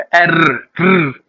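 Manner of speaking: trilled r sound, tongue rolled; a long trilled अर्र. Shown as a demonstration. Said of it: "टंग को रोल करके बोलना होता है".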